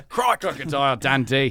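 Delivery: loud, slow, broad Australian; caricature Australian accent. Acted. Parodying the Australian caricature, loud broad Australian accent. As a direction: ham up an Australian movie catchphrase accent. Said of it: "caricature of an Australian person".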